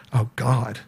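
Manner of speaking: low, soft private thought; retold inward dread. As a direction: think it aloud, quietly dismayed. Retold inward thought after shiver.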